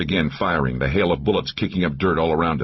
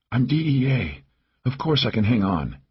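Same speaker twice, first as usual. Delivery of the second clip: raised-pitch plucky reply; wounded companion reassuring; 6 semitones higher than usual. A narrator's reading. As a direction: reassure a friend despite the injury. Wounded Laura reassures the narrator.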